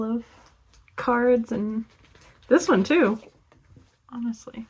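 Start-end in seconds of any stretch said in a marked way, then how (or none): 4.1-4.7 whisper. Real, not acted. Aside while showing cards.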